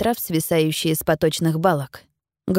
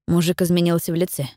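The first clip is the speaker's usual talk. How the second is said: ordinary delivery, nothing marked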